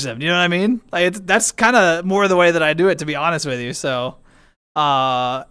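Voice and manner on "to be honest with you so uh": ordinary talk, nothing marked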